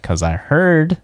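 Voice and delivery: ordinary talk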